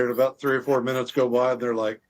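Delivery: ordinary delivery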